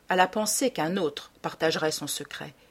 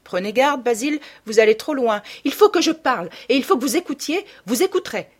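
urgent, higher and louder insistence; heated warning; 5 semitones higher, 5 dB louder than usual. Acted. Basil insisting that he must be heard, heated and urgent. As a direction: press the warning hard, voice raised.

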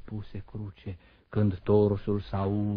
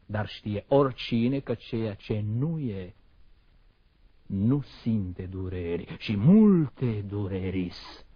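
normal talking voice

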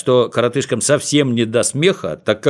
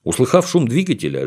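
plain reading voice

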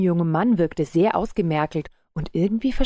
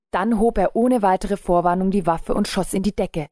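ordinary delivery

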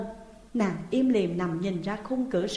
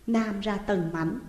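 ordinary delivery

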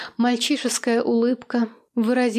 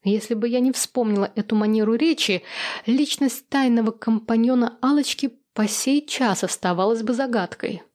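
usual speaking voice

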